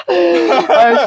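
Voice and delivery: a sudden high burst of laughter. Real, not acted. Host laughing at the man caught on camera, high cackle. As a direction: cackle at him, then call out.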